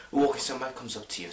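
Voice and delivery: ordinary talk, nothing marked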